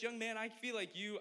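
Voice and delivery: high, accented old preacher impression; imitated elderly preacher's accent; Pastor Charlie's accent. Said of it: "I can't do the accent the whole time".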